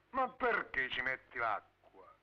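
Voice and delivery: drawn-out slow reproach; slow, reproachful. Acted. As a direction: spell it out slowly to her. Same question asked twice.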